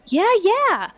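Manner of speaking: high, loud eager yeahs; excited yeah. Acted. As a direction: agree with a jolt of glee. Niece excitedly agrees.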